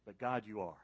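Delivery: ordinary delivery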